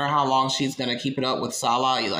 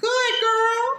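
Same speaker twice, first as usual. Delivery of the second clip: very high squealed praise; mock cheering; 4 dB louder than usual. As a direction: squeal it like gushing over a pet.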